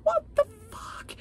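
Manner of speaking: high-pitched exasperated what the fuck; exasperated squeal. Real, not acted. Fan fed up with an author's rewrite, squealing in exasperation. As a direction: let it fly up in disbelief. Outraged reader ranting about rewrite.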